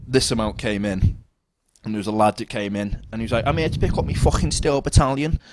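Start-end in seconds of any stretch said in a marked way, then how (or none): 3.5-5.4 a hint of a rude lad, a touch higher. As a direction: demand the order gruffly. Rude customer voiced.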